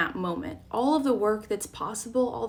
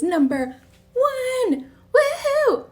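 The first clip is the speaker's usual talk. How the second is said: high, loud, drawn-out whoop; celebratory cheer; 7 dB louder, much slower than usual. Real, not acted. Host kicking off Mindfulness Mondays, celebratory cheer. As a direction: cheer it out, pitch soaring. Celebrates the first session live.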